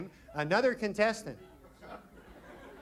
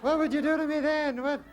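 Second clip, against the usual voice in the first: higher-pitched put-on girl voice; dating game girl; 9 semitones higher than usual. Girl questioning bachelor number two from a card, put-on girl voice. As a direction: play the girl contestant, pitched up high. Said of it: "Girl gotta go".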